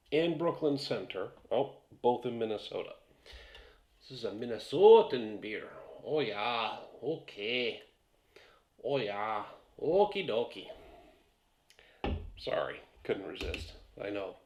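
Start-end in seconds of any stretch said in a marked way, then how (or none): 4.0-10.7 hammed-up Minnesotan accent with loud stresses; joking, over-the-top Minnesotan accent; horrible Minnesotan accent. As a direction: put on a badly faked Minnesota accent. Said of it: "horrible Minnesotan accent ever".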